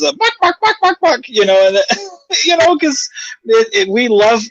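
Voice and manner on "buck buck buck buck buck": put-on high clucking like gossiping old hens. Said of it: "they're like the old hens".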